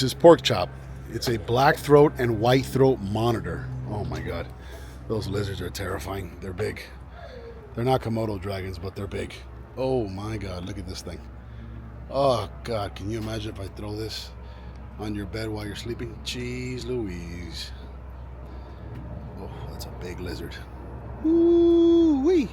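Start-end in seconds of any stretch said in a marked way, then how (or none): 3.9-20.5 softer, lower hushed awe; hushed awe at huge lizards. Real, not acted. Awed at monitor lizards. 21.2-22.5 high playful woo-wee; high whoop at a big lizard. Real, not acted. High exclamation.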